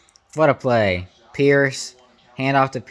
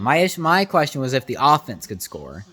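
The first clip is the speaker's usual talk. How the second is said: normal talking voice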